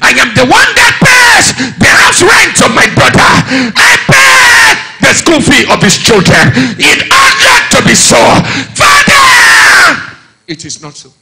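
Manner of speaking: a sibling shouting complaints at father, building; indignant complaint. Acted. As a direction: vent the family's unfairness. Voicing a frustrated sibling.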